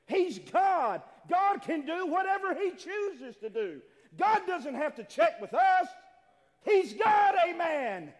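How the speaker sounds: high, loud declaration building to a shout; full-force preaching. Acted. Preacher insisting God answers to nobody, full-force preaching.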